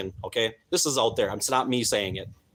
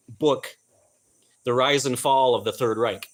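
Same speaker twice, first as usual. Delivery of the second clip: ordinary talk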